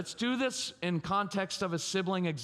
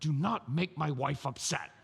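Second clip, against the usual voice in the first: upset dad. Acted. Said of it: "So you know what we're really saying?".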